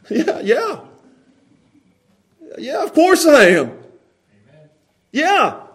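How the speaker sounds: high, breezy agreement to an angry accuser; unbothered cheerful yes. Acted. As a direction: agree happily, untroubled by their anger. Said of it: "This is how you respond to somebody who's mad".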